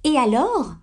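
high-pitched, haughty retort; full of dignity. A narrator's reading. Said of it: "d'un ton plein de dignité". (d'un ton plein de dignité)

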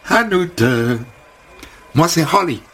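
high, bubbly American welcome; chirpy American hairdresser. Acted. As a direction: greet him like an eager stylist. Holly se présente. (coiffeuse américaine enjouée, voix haute)

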